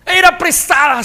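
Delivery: high, loud anguished wail; desperate cry. Acted. Young prophet wails to his master over the lost axe, loud and high.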